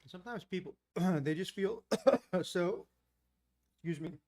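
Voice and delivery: coughing, chip stuck in throat; clearing a throat with chip stuck. Real, not acted. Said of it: "I got a chip, uh, stuck back there".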